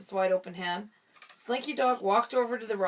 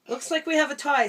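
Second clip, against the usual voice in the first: higher, louder announcement; Buzz announcing; 6 semitones higher, 6 dB louder than usual. A narrator's reading. Dad reading, Buzz announces the tie proudly. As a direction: declare it like a hero. Buzz line in read story.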